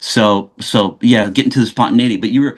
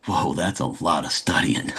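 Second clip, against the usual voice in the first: awed hush; 5 semitones lower, 8 dB quieter than usual. Retold or imitated. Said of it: "And then I was like".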